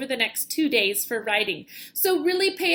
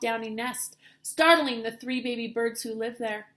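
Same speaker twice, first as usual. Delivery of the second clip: ordinary delivery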